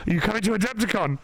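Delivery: high, fast excited questions; eager fans. Retold or imitated. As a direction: pile on the questions like an eager fan. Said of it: "friends being like".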